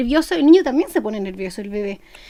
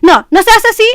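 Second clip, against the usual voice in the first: loud, higher sharp scolding; raised scolding voice; 6 semitones higher, 8 dB louder than usual. Shown as a demonstration. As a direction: tell the child off firmly. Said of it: "va a cambiar totalmente la concepción".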